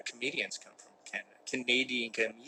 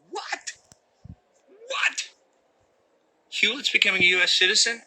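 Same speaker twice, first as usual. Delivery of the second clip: shocked high-pitched double take, louder on the last question; mock outrage; 10 semitones higher, 8 dB louder than usual. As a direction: play it as a scandalized gasp of disbelief.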